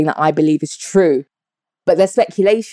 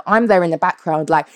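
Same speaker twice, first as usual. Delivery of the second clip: ordinary talk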